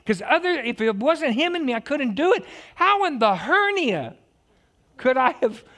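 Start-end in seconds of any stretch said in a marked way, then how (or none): 2.8-5.6 excited flub. Acted. Sorry laugh.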